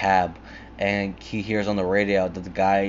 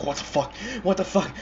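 high-pitched incredulous question; mock bewilderment; 10 semitones higher than usual. Two captives looking at each other, high mock bewilderment. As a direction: repeat it higher, baffled.

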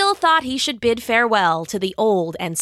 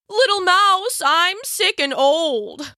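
crafty lion; 8 semitones higher, 3 dB louder, slower than usual. Acted. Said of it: "the crafty lion said".

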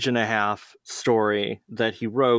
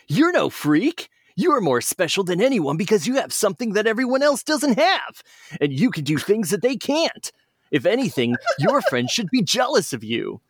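said kindly; 10 semitones higher than usual. Acted. Sonic comforting the teased little fox, kind high hero voice. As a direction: reassure the sad kid gently. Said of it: "said kindly".

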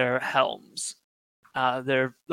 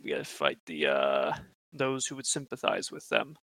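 much lower, quieter, ominous; low grim voice; 11 semitones lower, 6 dB quieter than usual. Acted. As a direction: drop into a grave murmur. Zealous keeper lowers voice.

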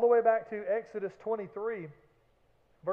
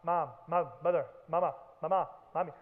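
lower, sing-song whiny repeated calls; nagging cartoon kid; 4 semitones lower than usual. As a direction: pester mom like a cartoon kid. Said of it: "the little cartoon kid".

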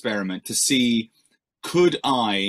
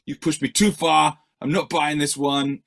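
higher, louder mock outrage; mock disgusted fan; 5 semitones higher, 3 dB louder than usual. Fan rejecting the new record, hammed-up outrage. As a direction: ham up the fed-up listener. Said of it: "surprised and disgusted ... and go".

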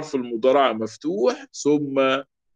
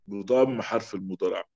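بضم حرف المضارع repeated lower and softer; 4 semitones lower, 6 dB quieter than usual. Shown as a demonstration. Teacher softly repeating the rule to the class. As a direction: drop it low as a soft echo. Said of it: "repeated rule".

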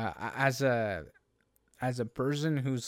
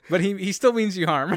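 laughing; 5 semitones higher, 8 dB louder than usual. Real, not acted. Joking, laughing.

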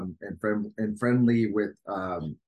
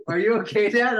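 daughter worried; 12 semitones higher, 8 dB louder than usual. Retold or imitated. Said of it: "And she's like".

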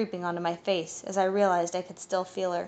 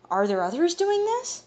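alarmed; 11 semitones higher, 4 dB louder than usual. A narrator's reading. Atanui alarmed at the news.

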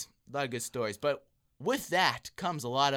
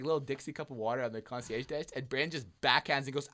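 ordinary talk, nothing marked

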